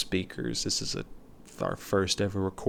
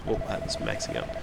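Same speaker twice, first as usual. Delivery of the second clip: normal talking voice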